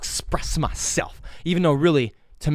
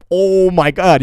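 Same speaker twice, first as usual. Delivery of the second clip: loud, higher-pitched outburst; emphatic exclamation; 5 semitones higher, 11 dB louder, much slower than usual. Real, not acted. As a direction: burst out with it loudly. Exclaiming at remembered times.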